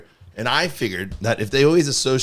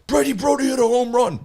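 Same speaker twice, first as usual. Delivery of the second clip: put-on high, squeaky kid voice; excited kids; 14 semitones higher, 5 dB louder than usual. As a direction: gush it like a thrilled kid. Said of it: "They're like".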